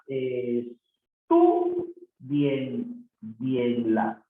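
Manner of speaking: "estudienla" extremely slow, pausing between syllables. Shown as a demonstration. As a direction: spread "estudienla" out, syllable after syllable. Teacher sounds out word for accent. (sílaba por sílaba, lentísimo)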